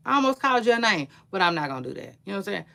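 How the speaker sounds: ordinary delivery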